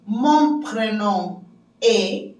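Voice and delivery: drawn-out French, word by word; slow, separated syllables; slow language-teacher model. Shown as a demonstration. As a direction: model the phrase slowly for beginners. Models French phrase for learners.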